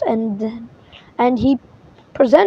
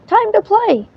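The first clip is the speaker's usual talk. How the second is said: eager call; 10 semitones higher than usual. Acted. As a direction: chivvy the cat into a game. Winnie urging Wilbur.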